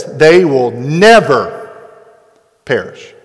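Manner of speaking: slow, emphatic NEVER, full stop, then "perish" drops away; stress and pause after NEVER. Acted. Preacher showing how Jesus said it, heavy stress on NEVER. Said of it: "Jesus said it like this".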